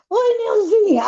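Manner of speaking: high-pitched imitation of a caller's hello; retold phone greeting. Friend greeting her by phone, acted.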